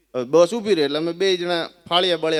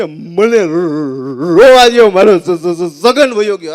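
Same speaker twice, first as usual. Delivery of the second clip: hammed-up sobbing; 12 dB louder, much slower than usual. Mourner wails he must cry for dead Chhagan. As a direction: choke on the words like a sobbing mourner.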